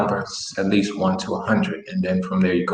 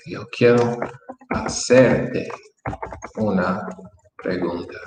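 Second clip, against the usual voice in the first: slow dictation. Shown as a demonstration. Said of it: "let me help you with".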